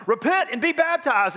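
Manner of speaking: hammed-up call to repent; mock preaching call. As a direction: belt it like a wilderness preacher. John voiced calling out.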